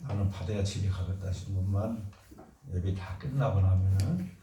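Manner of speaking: usual speaking voice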